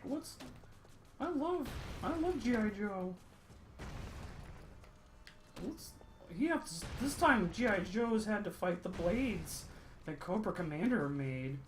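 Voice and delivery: high-pitched mock kid voice; imitated kid voice, high and eager; excited little kid. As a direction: play your younger self gushing over a favorite toy. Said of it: "when I was a kid".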